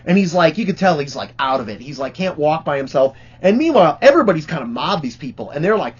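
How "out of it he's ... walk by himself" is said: ordinary delivery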